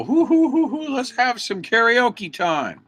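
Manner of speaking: mock the carefree partiers, hammed up; sneering imitation of a karaoke reveler; mocking party-goer. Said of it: "I'm not out here to go".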